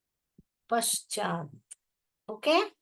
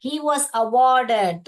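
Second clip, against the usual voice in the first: slow and louder, word by word; slow loud reading; 2 semitones higher, 6 dB louder, much slower than usual. Shown as a demonstration. Teacher reading the textbook line slowly and loudly. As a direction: read it out slowly for the class. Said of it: "teacher reading text slowly".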